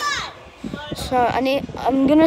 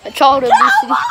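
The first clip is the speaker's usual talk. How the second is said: loud laughing yell; laughing shout; 8 semitones higher, 5 dB louder than usual. Real, not acted.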